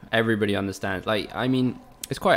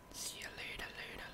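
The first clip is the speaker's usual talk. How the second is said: breathy whispered see you later; soft teasing whisper farewell; whispered goodbye; 20 dB quieter than usual. Pretend.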